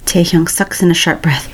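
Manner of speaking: ordinary delivery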